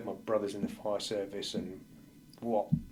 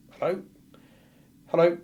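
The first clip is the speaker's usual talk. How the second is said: higher, louder hello into the phone; calling hello; 8 semitones higher, 10 dB louder than usual. Retold or imitated. Answering his ringing phone in the cinema, loud higher hello.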